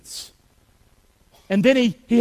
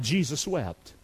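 ordinary talk, nothing marked

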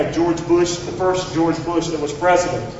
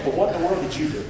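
normal talking voice

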